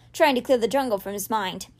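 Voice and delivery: usual speaking voice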